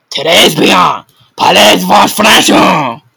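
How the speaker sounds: loud, high, slow French greeting; beaming teacher. Acted. Teacher beaming at Victor, asking loudly and slowly in French. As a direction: boom it out like a delighted teacher.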